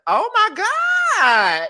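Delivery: high, slow, drawn-out oh my God; drawn-out moan. Retold or imitated. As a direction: let it ring out like a long moan. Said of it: "I'm like".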